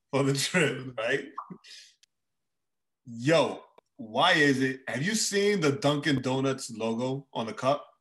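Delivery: usual speaking voice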